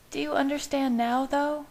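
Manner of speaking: high, soft questioning woman; soft woman's voice. A narrator's reading. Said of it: "she said softly".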